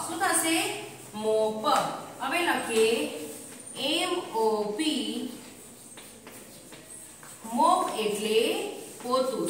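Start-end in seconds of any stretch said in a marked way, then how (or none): 3.9-5.3 very slow, stretched letters; letters drawn out one by one; spelling out. Shown as a demonstration. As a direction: spell it out as you write. Teacher spells while writing it.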